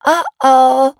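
hoch und deutlich lauter, schadenfroh; grinsend. A narrator's reading. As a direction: frech grinsend „Oh oh“ sagen. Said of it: "grinste Emma". (Schwester grinst den Bruder schadenfroh an, hoch und laut)

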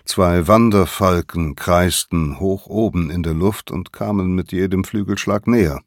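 ordinary delivery, nothing marked

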